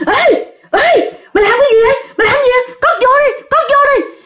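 very high, alarmed yelping; startled fear. Acted. Ghost sees the exorcist's needle, startled and scared. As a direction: cry out in panic at a threat. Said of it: "bà này nhìn mà bà sợ, bà giật mình".

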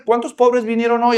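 usual speaking voice

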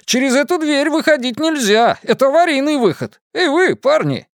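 громкий высокий окрик; окликнуть парней во весь голос; восклицание. A narrator's reading. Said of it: "воскликнул мистер Пиперс". (продавец кричит мальчишкам вслед, громко)

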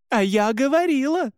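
very high, alarmed wail. A narrator's reading. Marie seeing the fangs, wailing I-told-you-so. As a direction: wail it out, pitch far up. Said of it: "тут же завопила Мари".

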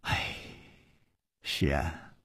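a touch of weary regret; long sigh. A narrator's reading. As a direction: sigh the words out, quietly rueful. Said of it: "长叹道".

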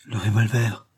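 ordinary delivery

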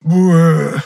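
высокий протяжный позыв рвоты; изобрази рвотный позыв; громкий рвотный звук. A narrator's reading. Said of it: "выразил свою позицию к еноховому предложению". (громкое протяжное «буэ»)

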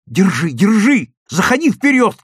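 high, loud urgent shout; excited boyish calling out. A narrator's reading. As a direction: yell orders in a chase. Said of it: "кричал Лёвка".

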